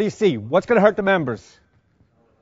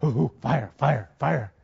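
lower, softer mock-excited firefighter fixated on fire; eager firefighter; 7 semitones lower, 6 dB quieter, slower than usual. Instructor mocking firefighters who only see fire, mock excited. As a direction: tease the crew who only see flames. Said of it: "What do we do as firefighters?".

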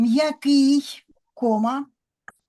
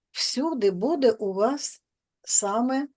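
ordinary talk, nothing marked

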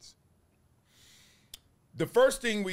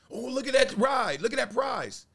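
put-on excited kid; 9 semitones higher, 4 dB louder than usual. Kid at Six Flags spotting ride.

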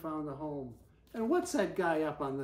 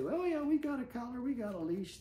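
put-on kids' line; 6 semitones higher than usual. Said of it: "trying to take their belts off and say".

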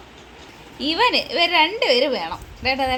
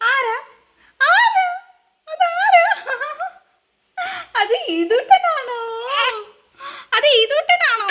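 very high cooing voice; 12 semitones higher, much slower than usual. Real, not acted. Mother asking baby who is in mirror, playful high cooing.

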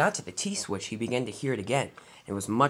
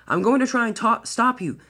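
high, raised voice of alarm; urgent call; 9 semitones higher, 5 dB louder than usual. A narrator's reading. Driver calling to Thomas as brakes apply, urgent shout. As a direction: call out over a runaway engine. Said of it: "called his driver".

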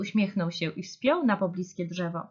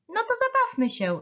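high-pitched playful invitation; encouraging. A narrator's reading. Little bear inviting friends to play, encouraging. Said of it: "zachęcał przyjaciół".

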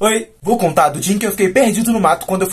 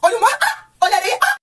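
very high-pitched squealing, punctuated by screams; hammed-up overexcited child shrieking at everything; freaking out with excitement; 11 semitones higher than usual. Child seeing the beach, calling to mom, wildly excited squeals. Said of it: "eu surtei. Fiquei assim".

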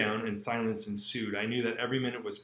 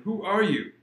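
alarmed question; 7 semitones higher, 12 dB louder than usual. Acted. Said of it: "exclaimed the old man".

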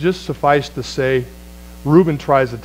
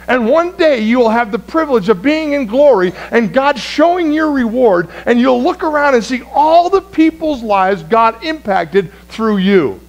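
higher, louder, slow preaching exhortation; full-force preaching; 9 semitones higher, 4 dB louder than usual. Acted. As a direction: rouse the seniors to act. Urges seniors at full force.

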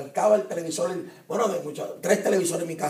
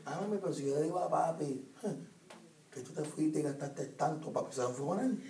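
put-on manipulative kid; 11 dB quieter than usual. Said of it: "eso es lo más que ustedes usan".

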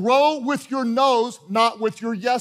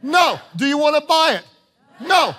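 "No." answered louder and higher; call-and-response no; 5 semitones higher, 4 dB louder than usual. Shown as a demonstration. Preacher drilling the congregation with call-and-response. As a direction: snap back a firm "No.". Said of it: "Are you gonna do it?".

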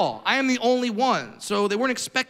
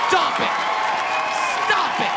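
high, forceful comic rebuke; exasperated scolding; 7 semitones higher than usual. Acted. Comic scolding coddling parents, exasperated. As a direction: cut them off, pitched right up.

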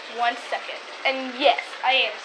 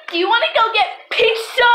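high, loud eager ask; bright hopeful invite; 8 semitones higher, 5 dB louder than usual. Pretend. Eager pizza question.

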